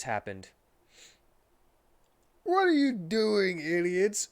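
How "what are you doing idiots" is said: higher, louder, slow angry shout. Acted. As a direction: catch the intruders and yell at them. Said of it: "shouted Vector".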